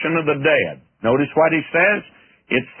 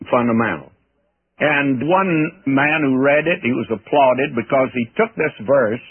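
usual speaking voice

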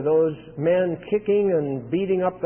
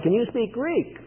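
higher, softly surprised question; mild surprise; 4 semitones higher, 4 dB quieter than usual. Retold or imitated. Commander answers Paul's request to speak, mildly surprised. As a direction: ask it with a touch of disbelief, pitch lifting. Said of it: "He was surprised".

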